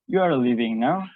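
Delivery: statement asked as a question, rising on "now"; "You are leaving now" with a questioning rise; rising question intonation. Shown as a demonstration. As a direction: let "now" swing upward at the end. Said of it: "spoken with a rising tone".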